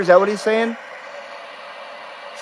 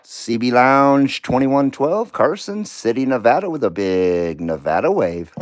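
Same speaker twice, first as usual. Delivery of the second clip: radio sign-off; 9 semitones lower than usual. Acted. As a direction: roll the station call out like a DJ.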